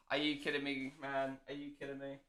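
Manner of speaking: very soft, weary muttering; hushed, barely voiced exasperation; deflated disbelief. Real, not acted. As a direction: mutter it to yourself, defeated. Dejected over the spill.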